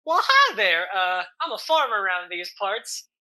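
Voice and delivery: hearty country hello. Acted. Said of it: "we have a redneck".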